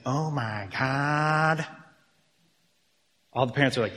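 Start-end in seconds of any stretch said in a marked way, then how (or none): 0.1-1.7 very slow, drawn-out cartoon voice; hammed-up animated-film catchphrase; kids' movie character. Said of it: "in this voice saying".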